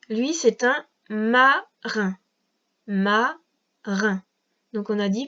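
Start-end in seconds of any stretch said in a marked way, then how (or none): 1.1-2.2 "marin" drawn out as ma, then rin; stretched ma and separate rin in "marin"; syllable-by-syllable dictation. Shown as a demonstration. Dicte un mot par syllabes. 2.9-4.2 "Marin" split, ma, pause, rin; two isolated syllables in "Marin"; syllable-by-syllable dictation. Shown as a demonstration. Répète le mot par syllabes.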